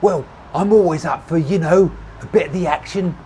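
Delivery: high-pitched holler over noise. A narrator's reading. Tommy pitching himself to the pair over traffic, hollering. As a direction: holler it across the cars. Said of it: "he hollowed".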